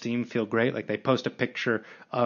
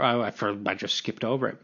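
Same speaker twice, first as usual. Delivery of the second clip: ordinary talk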